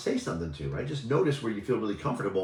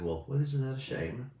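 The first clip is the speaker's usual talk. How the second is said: put-on light, imperfect British accent, pitched higher; Rich Litvin's British accent; 4 semitones higher than usual. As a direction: have a rough go at imitating a British accent. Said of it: "in his little beautiful British accent".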